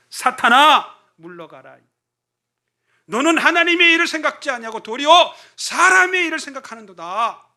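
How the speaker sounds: loud, high stern rebuke; 호된 꾸짖음. Acted. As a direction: rebuke Peter sharply before the disciples. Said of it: "아주 호되게 베드로를 꾸짖습니다". (제자들 앞에서 베드로를 호되게 꾸짖는 예수님, 크고 높게)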